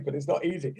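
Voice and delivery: normal talking voice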